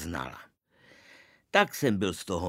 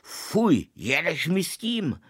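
startled father roaring, much higher and louder; terrible roar; 10 semitones higher, 5 dB louder than usual. A narrator's reading. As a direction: bellow it at the cat in fright. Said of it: "začal řvát hrozným hlasem". (vyděšený řev, mnohem výš a hlasitěji)

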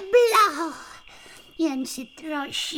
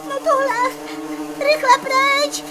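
panicked alarm; 7 semitones higher, 7 dB louder than usual. Acted. Realises she sits on wasps.

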